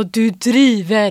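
loud, very drawn-out disbelief; incredulous. Retold or imitated. Finalist doubting the TV caller, incredulous. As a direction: lean hard and slowly on the words. Acted disbelief at phone news.

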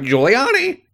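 high parrot squawk; talking bird. Acted. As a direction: screech the name like a cockatoo. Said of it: "most birds are like".

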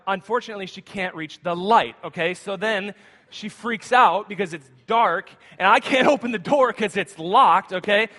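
ordinary talk, nothing marked

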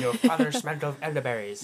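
put-on comic French accent; silly French-accented insult; Monty Python French accent. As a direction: finish the taunt in mock French. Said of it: "Monty Python".

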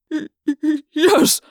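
stuttering frightened yes; terrified stammer. Acted. Trainer stammers in terror.

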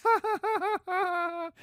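very high hammed-up laughing; mock cackling women. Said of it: "the women are like, laughs".

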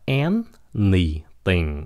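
ANYTHING drawn out slowly, stress on AN; first-syllable stress. Shown as a demonstration. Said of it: "Nhấn cái âm đầu". (nhấn âm đầu của "anything", đọc chậm)

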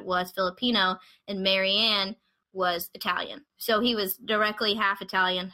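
ordinary delivery

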